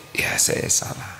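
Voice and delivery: meek whispered surrender; whispered admission. Acted. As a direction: whisper it meekly, giving in. Husband admits fault again. (bisikan pasrah mengaku salah)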